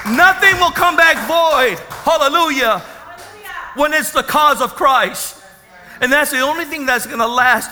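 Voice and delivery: shouted, rising preacher's cry; full-force preaching. Acted. Pastor shouting the climax to his congregation, fervent. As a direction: drive it home to the church, shouting.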